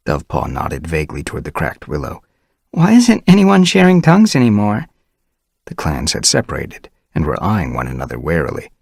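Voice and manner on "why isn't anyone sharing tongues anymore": high, louder puzzled question; puzzled young she-cat. Acted. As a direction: wonder aloud. Dovepaw puzzled.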